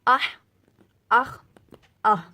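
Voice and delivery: آہ from mid throat, آخ rasped from the upper throat; throat letter drills. Shown as a demonstration. Teacher drilling throat letters for students, crisp and loud. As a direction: sound each throat letter apart. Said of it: "ہا کو ہم وسط حلق سے ادا کریں گے".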